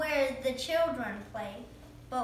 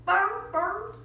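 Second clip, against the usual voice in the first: high loud playful dog noise; dog growl; 7 semitones higher, 7 dB louder than usual. Pretend.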